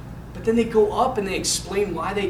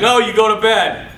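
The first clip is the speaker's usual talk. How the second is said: louder retold parental refusal; firm dad; 3 semitones higher, 6 dB louder than usual. Dad refusing son's plea, imitated firm order. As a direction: imitate a father refusing firmly.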